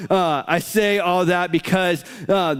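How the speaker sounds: normal talking voice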